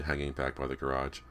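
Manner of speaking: plain reading voice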